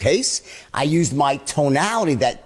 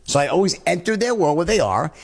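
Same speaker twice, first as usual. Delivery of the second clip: ordinary delivery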